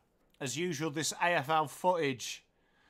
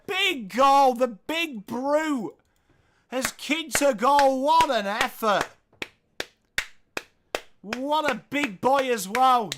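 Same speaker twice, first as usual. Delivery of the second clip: loud, high cheering of the goal; thrilled commentator, slow on the big brute; excited goal celebration; 6 semitones higher, 5 dB louder than usual. Real, not acted.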